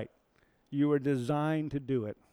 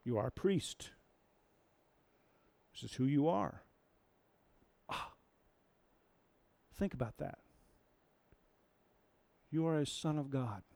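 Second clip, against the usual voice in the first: normal talking voice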